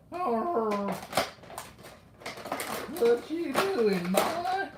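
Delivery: put-on high, loud, slow old west southern drawl; hammed-up southern cowboy accent. Shown as a demonstration. Collector demonstrating an old west character, exaggerated southern accent. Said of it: "like a southern voice from, like, the, the old west".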